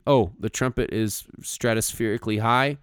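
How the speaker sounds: usual speaking voice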